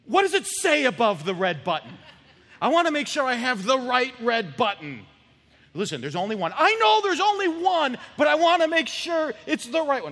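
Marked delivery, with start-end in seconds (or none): 0.1-5.1 loud, higher, hammed-up worry; anxious double-checking. Acted anxious novice pilot double-checking. 6.5-10.1 loud, high-pitched frantic protest; panicked insistence. Acted. Acted panicked novice arguing with tower.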